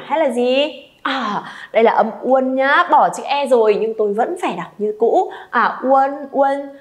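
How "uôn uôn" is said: ordinary delivery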